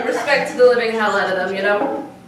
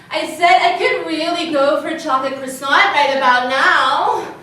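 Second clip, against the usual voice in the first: louder, insistent announcement to the room; pointed repeat; 3 semitones higher, 3 dB louder than usual. Acted. Tourist repeating herself after no one responds, pointed repeat. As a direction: project it so nobody can ignore you.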